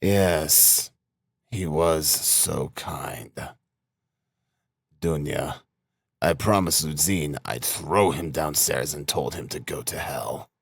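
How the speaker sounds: low, soft, weary sarcasm; grimly quiet. A narrator's reading. Sick brother telling Dunya of his threat, quiet grim voice. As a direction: let it sink softly toward the last words.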